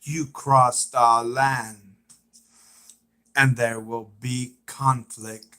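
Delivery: slow, drawn-out character voice; Alpha impression. Practising Alpha's warning about crossed lands, impression. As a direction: stretch each phrase, with long pauses. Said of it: "I'm trying to do an impression of".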